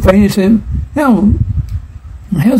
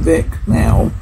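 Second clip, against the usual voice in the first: urgent whisper; whispered urgent command. Acted. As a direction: hiss it under the broadcast. Urgent aside during recipe.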